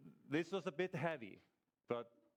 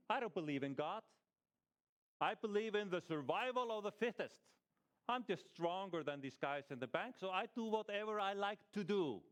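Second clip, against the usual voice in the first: higher, pushy mock defiance; defiant robber; 7 semitones higher, 3 dB louder than usual. Robber mocking those who call it wrong, higher and defiant. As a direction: taunt them like you answer to no one.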